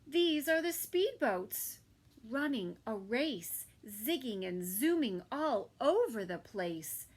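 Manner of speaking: put-on lively storybook reading for kids; reading to children. A narrator's reading. Narrator reading a story to children, lively. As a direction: read it like a bedtime picture book. Reading picture book aloud.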